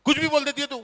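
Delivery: loud mock scolding, high; retold shout. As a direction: yell it at her in disbelief. Shouting at wife about Hyderabad.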